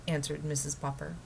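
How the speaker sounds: ordinary delivery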